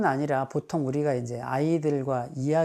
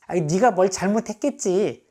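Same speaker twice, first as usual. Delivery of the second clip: blaming; 4 semitones higher, 5 dB louder than usual. Shown as a demonstration. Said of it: "정말 나쁜 말".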